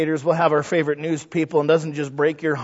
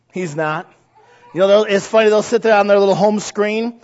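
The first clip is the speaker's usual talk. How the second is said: ordinary delivery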